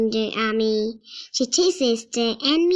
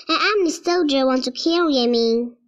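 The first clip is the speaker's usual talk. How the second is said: plain reading voice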